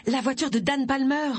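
high cry of sudden realisation; shouted. A narrator's reading. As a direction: blurt it out urgently. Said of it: "cria Agatha".

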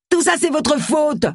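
high, slow mock yell with no extra force; yelled accusation. Simon blames Agatha for Tony leaving, in a high slow mock yell. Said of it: "hurla Simon".

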